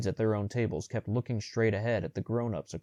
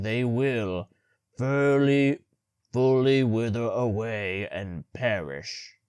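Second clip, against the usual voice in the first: loud, slow, stumbling speech; halting reading; 5 dB louder, slower than usual. A narrator's reading. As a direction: struggle through the text. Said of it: "how many times he paused".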